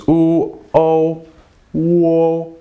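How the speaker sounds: high held "u", "o", then blended "wo"; vowels blending. Shown as a demonstration. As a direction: sound out "u" and "o", then join them as "wo". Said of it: "in Pinyin originally this was".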